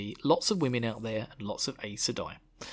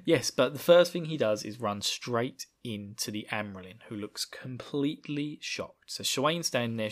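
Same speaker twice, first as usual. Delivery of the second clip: normal talking voice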